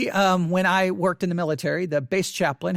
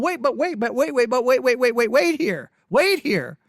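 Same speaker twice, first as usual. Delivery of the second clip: objecting; 3 semitones higher than usual. Retold or imitated. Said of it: "many men here going".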